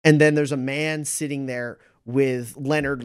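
ordinary talk

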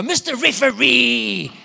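high, loud, drawn-out mock kid's yell; child calling the referee. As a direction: imitate a kid hollering across a sports field. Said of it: "one of my children go".

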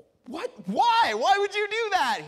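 high, loud disbelieving squeak; shocked child. Retold or imitated. Young [name] answering the bully, shocked and high. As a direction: act out a stunned little kid. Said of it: "I'm like".

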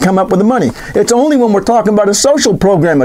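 usual speaking voice

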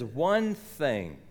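one thing drawn out low and slow; slow emphatic Curly. Retold or imitated. Cowboy pressing the point home to Mitch, slow and weighty. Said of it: "He says".

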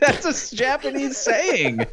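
high, laughing self-defence; laughing protest. Real, not acted. Laughing protest at racism jibe.

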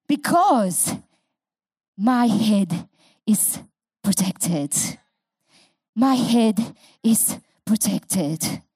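low, slow declaration. Real, not acted. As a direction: drop the voice and say it slowly. Quiet declaration after shouted climax.

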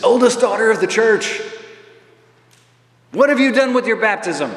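loud, high, ringing public challenge; John Paul II critique. Retold or imitated. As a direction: call out a reproach to a huge crowd. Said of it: "that was a critique".

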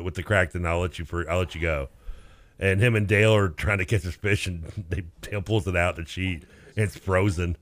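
normal talking voice

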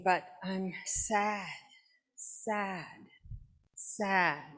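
soft, slow, pitiful sadness; sad and pitiful. Acted. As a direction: sigh it out like a sulking dog. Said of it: "And then pitifully wrung his paws".

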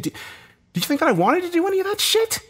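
very high, strained protest; exasperated, high-pitched challenge; indignant outburst. A narrator's reading. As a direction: burst out defensively, pitch soaring. Acted quarrel, defending himself.

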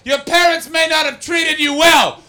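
loud, slow, shouted verdict from the crowd; heckling Scottish woman. Retold or imitated. Comic mocking a heckler, slow loud shout. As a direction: bellow it like a heckler standing up mid-show. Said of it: "a woman stood up and went".

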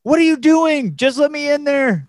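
high, loud retold outburst; high-pitched mock-desperate demand; exasperated plea.

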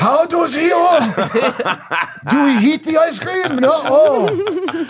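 high, laughing fake Japanese accent; hammy Japanese accent through laughter; bad Japanese accent. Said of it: "Why am I doing a bad Japanese, uh, accent?".

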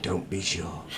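hushed, low, menacing; ominous badger. Badger answers the plea, quiet and menacing. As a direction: drop it to a dark murmur. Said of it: "quoth the badger".

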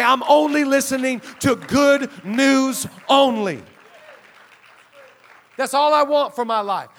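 loud, rising rally to the congregation; fired-up pulpit climax; full-force preaching. Real, not acted.

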